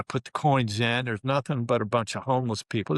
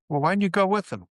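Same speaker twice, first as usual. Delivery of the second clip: mom's voice; 8 semitones higher, 3 dB louder, faster than usual. Retold or imitated. Parents voiced on phone call.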